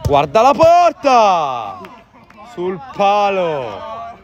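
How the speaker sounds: loud, drawn-out near-goal cry; excited commentary. Real, not acted. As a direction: rise then groan at the post. Near goal, hits post. (telecronaca concitata)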